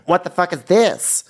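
Brooke's high drawn-out echo of outrage; high slow mock outrage. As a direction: stretch it out, squeaky and scandalized. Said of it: "And Brooke says".

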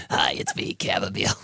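hushed, whispery tea character; chamomile voice. Acted. Chamomile tea saying hi, hushed character voice. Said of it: "is that the voice?".

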